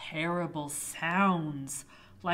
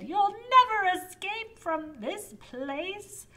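high witch voice; 11 semitones higher, 6 dB louder than usual. Acted. Witch threatens knight.

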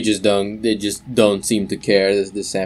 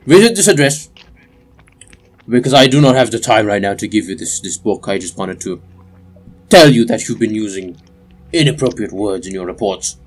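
raised, clipped instructions; stern official; 2 semitones higher, 5 dB louder than usual. Acted. Official instructs the player to visit his address, raised. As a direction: give orders as a fussy bureaucrat. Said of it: "he tells you".

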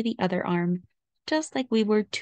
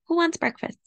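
higher, bright question; cheerful mother; 9 semitones higher than usual. A narrator's reading. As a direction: offer breakfast lightly. Mommy cheerfully asks family.

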